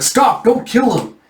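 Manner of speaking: sharp high cry to stop him; urgent shout. Retold or imitated. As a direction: yell to halt the blow. Yun stops a killing.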